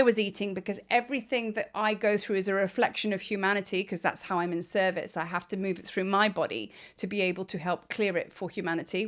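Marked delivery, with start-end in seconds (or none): none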